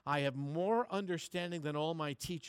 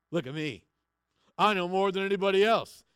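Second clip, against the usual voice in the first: much louder, puffed-up bragging; mock boastful; 5 semitones higher, 13 dB louder, faster than usual. Pastor mocking a proud know-it-all, loud mock boast. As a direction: sneer it like a show-off proud of his learning. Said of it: "a person who is puffing out their chest".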